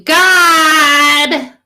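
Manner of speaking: long high scream to heaven. Shown as a demonstration. Woman screams out to God like her daughter, screaming. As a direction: scream it out at full pitch, drawn out. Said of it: "I scream out to you".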